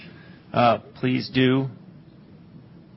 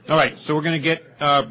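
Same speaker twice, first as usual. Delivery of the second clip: ordinary talk, nothing marked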